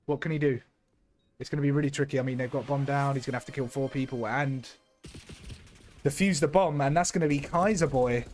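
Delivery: caster's match call, a bit brighter; live play-by-play. Acted. Caster calling the round for the stream, live play-by-play.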